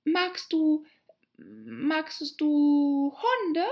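high, drawn-out hesitant question; groping question. Acted. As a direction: stretch it out while searching for a safer topic. Alice groping for a new subject. (zögernd gedehnte, hohe Frage)

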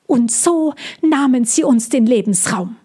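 the bird accusing the dragon's kind. Acted. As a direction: lay the blame on the dragon.

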